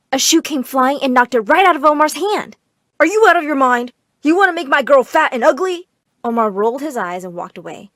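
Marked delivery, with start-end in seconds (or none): 3.0-5.9 loud, raised-pitch indignation; exasperated parent, voice up; outraged scolding. A narrator's reading. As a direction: tell them off as if appalled. Parent scolding over ice cream.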